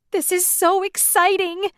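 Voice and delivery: high, eager gush; bubbling over with thrill; excited. A narrator's reading. Happy thrilled at the sparkling gift. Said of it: "said Happy".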